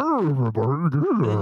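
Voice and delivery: slow, distant menacing villain voice; a hint of Bane, drawn out. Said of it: "I remember Bane".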